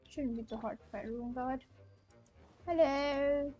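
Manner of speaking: ordinary talk, nothing marked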